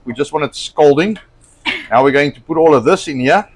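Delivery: normal talking voice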